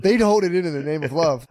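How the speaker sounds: normal talking voice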